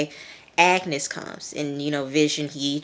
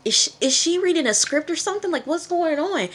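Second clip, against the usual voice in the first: much higher, fast, incredulous; baffled disbelief; 12 semitones higher than usual. Retold or imitated. Reviewer reliving her confusion at the scene, high and fast. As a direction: rush it out, higher and stunned. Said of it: "I'm like".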